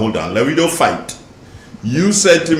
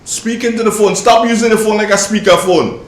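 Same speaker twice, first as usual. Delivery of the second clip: raised voice, much higher and louder; shouting; 9 semitones higher, 4 dB louder than usual. Real, not acted. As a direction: call out sharply to a bad line. Host shouting at faint caller.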